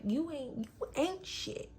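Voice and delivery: high, drawn-out scornful insult; mock contempt. Boyfriend's insult re-enacted.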